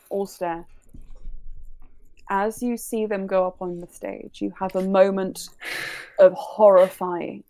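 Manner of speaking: story narration. A narrator's reading. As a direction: tell it like a dramatic reveal. Dramatic scene narration.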